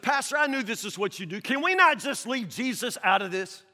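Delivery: high, loud pushback; exasperated man. Retold or imitated. Grieving man refuses Jesus.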